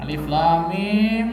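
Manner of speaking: slow, drawn-out Quranic opening; mock showy recitation. Preacher imitating a show-off praying before watchers, drawn-out recitation. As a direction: stretch each letter name as if performing for onlookers.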